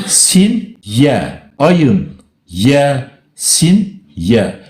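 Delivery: "Ayın, ye, sin, ye" as separate letter names, with falling ends; spelled letter by letter. Shown as a demonstration. Debater spelling the written name, "Ayın, ye, sin, ye" letter by letter. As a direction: count off "sin", "ye" one letter at a time. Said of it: "Kitapta yazılı olan dört harftir".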